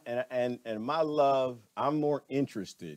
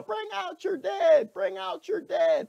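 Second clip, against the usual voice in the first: much higher, slow, shouted "Bring out your dead"; plague cart crier; 3 dB louder, slower than usual. Retold or imitated. Imitating the bell-ringing crier from the movie, slow high shout. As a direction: bellow it like a crier ringing his bell down the street. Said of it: "He had the bell and he was ringing the bell".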